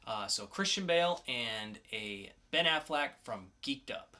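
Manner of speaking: normal talking voice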